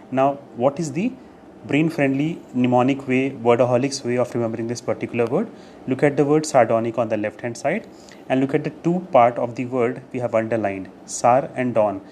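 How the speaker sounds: normal talking voice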